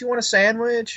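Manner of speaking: a hint of a sweet, nice wife offering food; mock friendly Wendy. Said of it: "she's being all friendly and nice".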